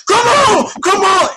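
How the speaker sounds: high, loud mock shout of a rowdy fan; hammed-up stadium holler; imitating a yell. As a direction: yell it like the fan behind you at the game. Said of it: "And he was yelling".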